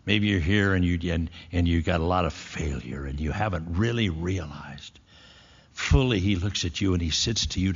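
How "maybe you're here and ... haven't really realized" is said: ordinary talk, nothing marked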